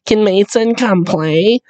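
drawn-out mock kid voice; imitated little kid. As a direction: ask like a child at the door. Voicing kids asking to play.

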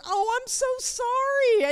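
high, slow, hammed-up sorry; a fretful believer apologising to Jesus; mock apology. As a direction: grovel in mock dismay. Acted apology.

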